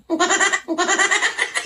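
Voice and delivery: short high laugh. Real, not acted. Streamer laughing at a gift name.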